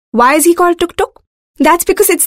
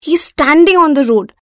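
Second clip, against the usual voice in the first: plain reading voice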